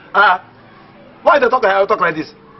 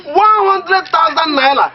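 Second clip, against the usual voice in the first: amazed exclaim; 10 semitones higher, slower than usual. Real, not acted. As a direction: stretch out the sum, marvelling. Amazed at the money.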